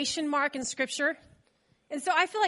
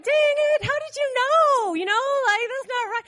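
much higher, louder mock dismay; caught out exclaim; 9 semitones higher, 5 dB louder than usual. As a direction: squeal it like a kid caught in the act. Retold being caught by brother-in-law.

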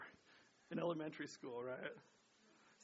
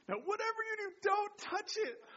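stern warning mom; 12 semitones higher, 8 dB louder than usual. Retold or imitated. Mom warns the kids off the ark, hammed-up stern. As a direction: warn the kids like a strict mom. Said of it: "imagine my mom saying".

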